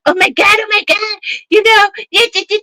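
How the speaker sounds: high, mocking shocked voice; hammed-up horrified pearl-clutcher; appalled critic. Mocking people who call whooping terrible parenting, high shocked voice. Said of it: "they act like".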